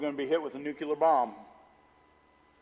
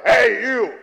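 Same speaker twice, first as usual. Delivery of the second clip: mock shouted call, louder and higher; imitating a shout; 5 semitones higher, 9 dB louder than usual. Store lady calling out to the pastor, mock shout. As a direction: bark it like a stranger calling you out. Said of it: "this lady sitting there going".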